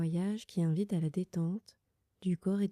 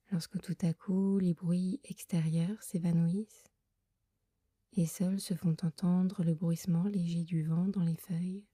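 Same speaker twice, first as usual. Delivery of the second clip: guided meditation. Acted. Leads a Yoga Nidra meditation. (voix douce et lente de méditation guidée)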